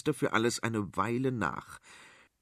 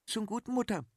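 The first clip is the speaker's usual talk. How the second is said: soothing daughter; 11 semitones higher, 4 dB quieter than usual. Acted. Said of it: "lenkte Prinzessin Adela ein".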